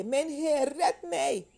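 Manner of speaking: high, loud cry for rescue; dramatic shout for help, pitched high; acted desperate cry. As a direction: cry out as if sinking. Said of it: "Hij schreeuwde het uit".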